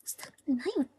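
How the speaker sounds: quick whispered Japanese read to herself; fast whisper. Real, not acted. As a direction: rush it out in a whisper. Reading manga aloud to self.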